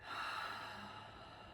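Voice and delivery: long, airy sigh out through the mouth; long exhale. Shown as a demonstration. Guide showing the group a breath out through the mouth, long exhale. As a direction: let a deep breath go slowly.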